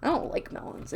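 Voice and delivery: "I don't like melons" whispered, breathy and unvoiced. Shown as a demonstration. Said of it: "I've already made that very clear".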